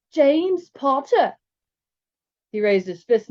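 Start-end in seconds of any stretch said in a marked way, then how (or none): 0.1-1.4 high, loud, drawn-out mock English; ridiculous English accent. Zane mimics a spy introducing himself, ridiculous English accent. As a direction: ham up a bad English accent, very slowly. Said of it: "ridiculous attempt at an English accent".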